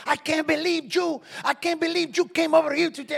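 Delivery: high, loud outraged scolding; mother going off. Retold or imitated. Cuban mother scolding the preacher, loud and outraged. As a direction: scold him like a furious mother. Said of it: "she's going to town".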